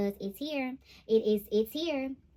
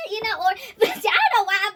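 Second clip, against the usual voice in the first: high, loud giddy laughter; excited laughing squeals; 12 semitones higher, 7 dB louder than usual. Real, not acted. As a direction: shriek with joy. Laughing excitedly about union card.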